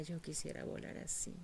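faint, sad whispered wish; sad longing. Acted. Eagle watching its sister fly, sad whisper. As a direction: say it as a hopeless wish, barely aloud. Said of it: "la veía con tristeza".

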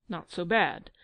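usual speaking voice

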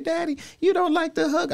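imitating his daughter. Dad quoting his daughter's complaint, in a high childlike voice. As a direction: pitch way up to play his nine-year-old daughter. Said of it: "She said".

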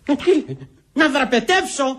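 high, startled outcry; shouting. Acted. Prisoner shocked by a secret escape proposal, shouting. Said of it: "τι φωνάζεις".

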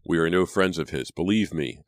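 ordinary delivery

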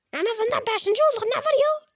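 high, put-on character voice; Mortis protesting. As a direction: argue back in a high-pitched voice. Mortis protests to his scolding partner.

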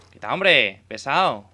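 loud irritated shout at a teammate; real frustration, yelled; annoyed yell. Real, not acted. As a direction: bark it out, fed up.

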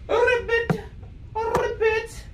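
high, croaky-style ribbit; frog imitation.

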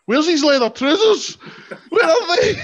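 high, loud frantic searching voice; mock panicked Kevin Nash. Kevin Nash backstage, frantically searching for his trousers, loud. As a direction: play it as a flustered giant hunting his trousers. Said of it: "He sees Kevin Nash just running about going".

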